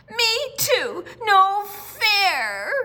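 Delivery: put-on high loud childish wail. A narrator's reading. Lucille wailing at her family that it's not fair. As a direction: wail it like a left-out kid. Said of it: "Lucille wailed".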